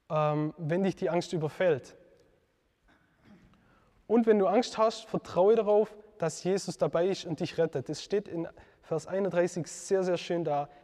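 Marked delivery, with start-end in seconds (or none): none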